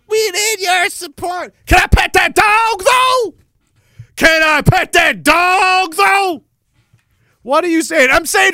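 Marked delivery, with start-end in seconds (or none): 0.1-1.5 mock politician. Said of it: "when these politicians come around". 1.7-6.4 loud, high, bratty demand; defiant mock shout. To politicians asking support, a high defiant shout. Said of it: "that's what I'm gonna start saying".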